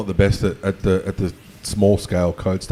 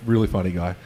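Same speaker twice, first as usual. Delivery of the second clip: usual speaking voice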